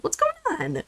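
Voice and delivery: high, soft cooing pet voice. Real, not acted. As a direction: coo it at the kitty. Cooing at cat, then plain.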